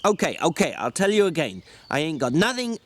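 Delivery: a weary ranch hand berating his companion; George, exasperated. Acted. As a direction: snap at him like a fed-up older brother. George grumbles at Lenny.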